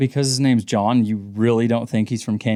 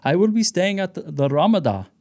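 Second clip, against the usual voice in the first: Ramada with wrong-syllable stress, imitated Indian accent; high-pitched imitation of an Indian friend's accent; put-on Indian accent, odd stress on Ramada; 8 semitones higher than usual. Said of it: "The wrong emphasis on the wrong syllable".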